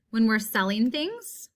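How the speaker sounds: ordinary delivery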